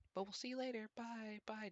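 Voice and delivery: put-on high, gentle children's-TV host farewell; sing-song kindly goodbye like a TV neighbor; Mister Rogers impression. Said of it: "doing his whole Mister Rogers thing".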